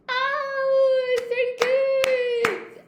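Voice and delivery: high, loud, drawn-out squeal; delighted exclamation. Real, not acted. Teacher reacting to Anna Maria's joke about eating words, delighted squeal.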